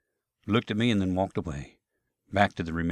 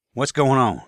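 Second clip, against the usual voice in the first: usual speaking voice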